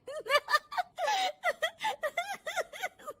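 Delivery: high-pitched giggling fit; a bahu in fits at the TV; loud laughter. Acted. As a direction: laugh in long high peals. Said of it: "बहू के जोर जोर से हंसने की".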